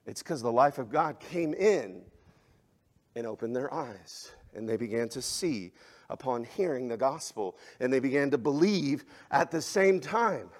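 usual speaking voice

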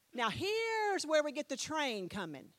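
normal talking voice

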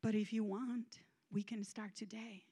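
soft, low invitation; gentle offer. Retold or imitated. God gently offering to start today, soft and low. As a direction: offer a fresh start tenderly. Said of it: "he immediately said to me".

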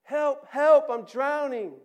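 frantic high scream for help; desperate drowning cry; shout. Acted. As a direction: yell as if going under. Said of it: "sees you".

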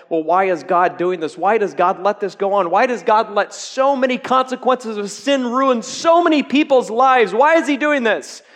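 pleading why at full force, climbing; escalating cry of why; rising anguished questions. Acted. Preacher voicing congregation's anguished questions.

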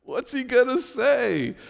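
high, drawn-out gasp of surprise; shocked congregation member, amused; mock shocked listener. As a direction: play the stunned listener catching the cuss. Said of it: "you're like".

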